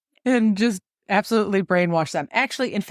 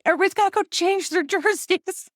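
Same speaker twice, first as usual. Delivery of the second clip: much higher yell, laughing at herself; yelling at family; 12 semitones higher than usual. Retold or imitated. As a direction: shout the order to the family, half laughing. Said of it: "and I yell at everybody".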